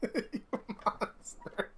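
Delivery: high, soft, voiceless laughed words; wheezy, barely voiced accusation; breathless laughing reproach. Real, not acted. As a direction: gasp it out weakly through laughter. Laughing reproach to game's maker.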